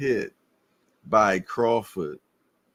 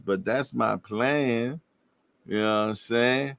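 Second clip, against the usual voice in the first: usual speaking voice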